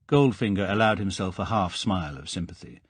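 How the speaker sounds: plain reading voice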